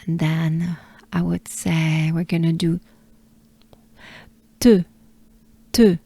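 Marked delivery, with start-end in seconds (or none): 4.6-6.0 "te" short with rounded French e; French e sound. Shown as a demonstration. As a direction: model clipped French "te". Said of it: "So the E sound".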